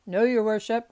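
loud, prompt correction. A narrator's reading. Clerk correcting the judge about the wig, loud. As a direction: speak up firmly to the bench.

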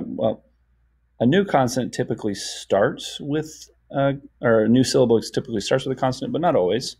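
usual speaking voice

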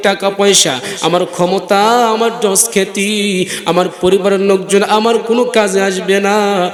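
usual speaking voice

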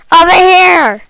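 loud shout across; calling out. Acted. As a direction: call someone over. Calling player over.

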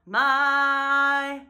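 "my" sung with jaw open, tongue closing; held-jaw my. Shown as a demonstration. Coach repeats the word to show the jaw. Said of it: "I was able to hold my jaw".

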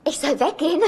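normal talking voice

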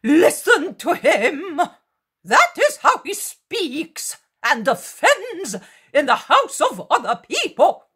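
high, wild heckling with swooping pitch; frenzied hecklers. A narrator's reading. Hostile crowd threatens Jesus with stones, frenzied and high. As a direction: rail at him like a frenzied mob.

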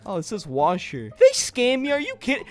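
usual speaking voice

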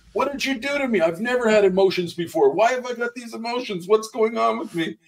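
high, bewildered mock panic; retold freak-out. Minister imitates biker back in church with new emotions, freaking out. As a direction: imitate a rattled man firing off high-pitched questions. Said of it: "he starts freaking out".

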